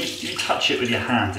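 usual speaking voice

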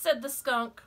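ordinary delivery, nothing marked

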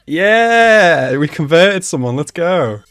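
loud, high drawn-out celebration; real excited whoop; triumphant cheer. Real, not acted. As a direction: cheer like your team just scored. Cheering a win on screen.

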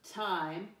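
normal talking voice

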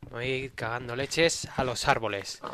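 ordinary delivery

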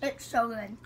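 eating a chocolate piece; chewing while talking. Real, not acted. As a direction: mouth partly full of chocolate. Seen 4: Teal-shirt speaker holds bitten chocolate, mouth working between words.

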